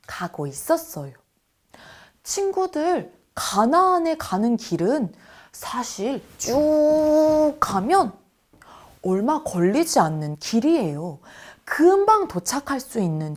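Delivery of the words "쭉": 쭉 drawn out long and higher; stretched emphasis. Shown as a demonstration. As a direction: stretch 쭉 like a straight road. Showing a straight short road. (쭉을 높고 길게 늘여서)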